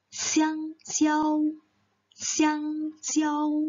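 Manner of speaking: "Xiang xiao" high and level, leading the class; group read-aloud. Shown as a demonstration. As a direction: lead the class in reading together. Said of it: "kita baca sekali lagi ya". ("Xiang xiao" dibaca tinggi bersama-sama)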